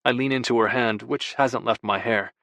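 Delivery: ordinary delivery, nothing marked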